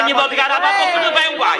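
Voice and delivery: high, shouted reproach; angry shout. Acted. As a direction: yell at him for always causing trouble. Brothers quarrel at the bedside.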